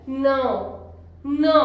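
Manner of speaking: no said deep and through the nose, like nasal "now"; no with a nasal ending. Shown as a demonstration. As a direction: make no sound from deep inside. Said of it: "बिल्कुल अंदर से आवाज आती है".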